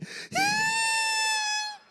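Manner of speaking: very high, sustained whine; mock rage squeal. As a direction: keep squealing with comic fury. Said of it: "I've never been this angry in my life".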